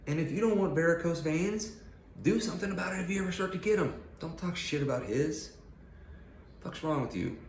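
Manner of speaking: high, pushed, scolding; heated lecture. Real, not acted. Scolding critics about his varicose veins, heated. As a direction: lay into them.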